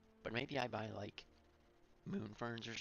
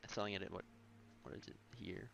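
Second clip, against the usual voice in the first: normal talking voice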